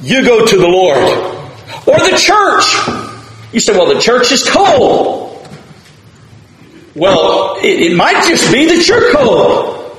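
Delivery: impassioned preacher; preaching at full force. Acted. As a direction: urge them to go to the Lord. Exhorting the congregation.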